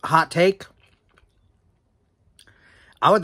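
speaking between bites of pizza; talking while chewing. Real, not acted. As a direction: mouth partly full of food. Seen 0: Bite at -1.0; lips pressed, likely chewing early, slice held throughout.

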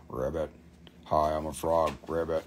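deep croaky ribbit, playing a frog; mock frog voice. Seller voices her frog stone for viewers, low mock frog. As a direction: let the little frog talk in a low croak.

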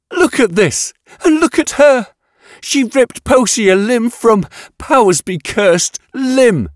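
very high, strained, agitated accusation; outraged outburst. A narrator's reading. Scientist accuses the blood-splattered woman over a death, high and outraged. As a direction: cry out in high-pitched outrage. Yatena's screamed outburst continues.

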